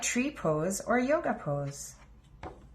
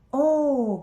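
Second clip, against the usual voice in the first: a sharp, loud surprised oh; the children gasp in wonder; high, loud excited oh; gasp of surprise; 6 semitones higher, 7 dB louder than usual. A narrator's reading. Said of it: "gasp the children".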